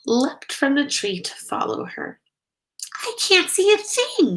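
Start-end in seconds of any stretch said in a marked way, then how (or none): 2.9-4.4 high-pitched panicked yell. A narrator's reading. Pip yells to Stella Luna in the dark, panicked. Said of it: "yelled Pip".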